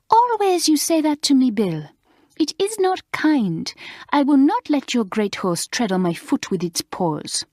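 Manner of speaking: a horrified French lady waving horses away; French governess. Acted. Mademoiselle refusing to go near a horse, in a fussy French accent.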